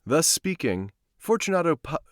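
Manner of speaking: plain reading voice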